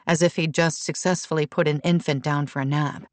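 usual speaking voice

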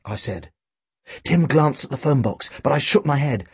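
usual speaking voice

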